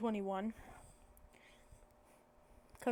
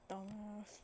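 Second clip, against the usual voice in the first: much softer, muttered insult; under the breath; 10 dB quieter than usual. Retold or imitated. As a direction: drop it to a quiet mutter. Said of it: "kind of loud, but under his breath".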